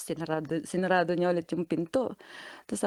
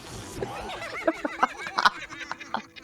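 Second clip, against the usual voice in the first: high laugh; 5 dB quieter than usual. Real, not acted. Laughing at her own story.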